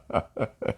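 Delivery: soft trailing chuckle. Real, not acted. Joking about Russia's treasury against England's, soft chuckle. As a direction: laugh quietly at your own joke. Laughs at his own absurd contest.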